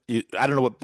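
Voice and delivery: ordinary delivery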